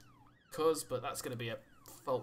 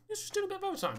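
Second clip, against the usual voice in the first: mock-breezy cover; 3 dB louder, faster than usual. Covering up officer's death.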